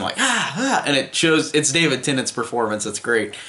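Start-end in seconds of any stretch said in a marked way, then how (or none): 0.2-0.8 imitated scream. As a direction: mimic a movie character screaming without full volume. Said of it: "just screams at him".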